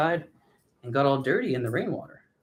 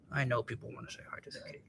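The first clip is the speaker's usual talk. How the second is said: quick, hushed whisper; coaxing whisper; 14 dB quieter, much faster than usual. Real, not acted. Owner coaxing the cat for viewers, quick whisper. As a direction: whisper it fast to coax a pet.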